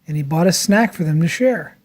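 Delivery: ordinary delivery, nothing marked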